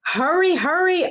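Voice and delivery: high, hurried call to go; a leprechaun urging his friends to rush; urgent order. Acted. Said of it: "Art ordered".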